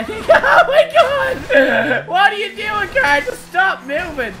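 high, loud shrieking laugh through the words. Real, not acted. Streamer yelling at runaway car, laughing shriek. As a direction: crack up and squeal at the car. Car goes wild in game.

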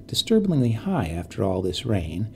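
usual speaking voice

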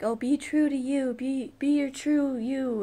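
sing-song mock of preachy advice; mocking people's advice. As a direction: parrot the cliché with a mocking lilt. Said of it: "people are always like".

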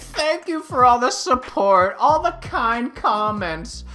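high, drawn-out gushing thanks; mock grateful YouTuber. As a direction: imitate a weepy vlogger thanking fans, loud start then slowing. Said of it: "He's gonna make a video in a week and saying".

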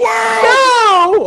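high, loud, hammed-up "No!"; mock anguished cry. Riffing on a movie, hammed-up anguished cry. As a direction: wail it like a melodramatic hero.

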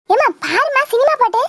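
normal talking voice

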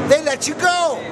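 ordinary talk, nothing marked

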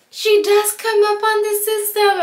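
high put-on voice of the TSA agent confirming the ID; imitating the TSA lady. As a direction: mimic the TSA lady reading her screen, pitched well up. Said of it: "and then she's like".

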